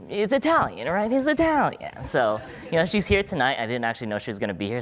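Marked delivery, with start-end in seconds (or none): 0.1-3.6 higher and louder, playful; animated. Real, not acted. Joking about the dean who is in the audience. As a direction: tease the dean in good fun. Teasing about the dean's pronunciation.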